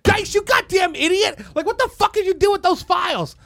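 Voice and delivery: high, shouted dressing-down; imitated furious corporate boss; mock angry boss. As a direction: tear into an employee, hammed up. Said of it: "somebody spoke to me. They're like".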